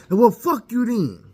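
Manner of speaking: high, loud, slow furious curse; wife cursing him on her way out, loud and slow; angry wife. Retold or imitated. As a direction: spit it out slowly as she leaves. Wife storms off.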